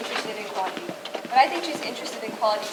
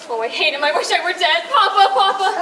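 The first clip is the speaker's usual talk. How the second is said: panicked pleading; 6 semitones higher, 6 dB louder than usual. Acted. Bride panics before wedding.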